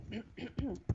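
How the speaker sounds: a short demo clear of the throat; small throat-clearing demo. Shown as a demonstration. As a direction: clear your throat to show the symptom. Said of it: "but throat clearing".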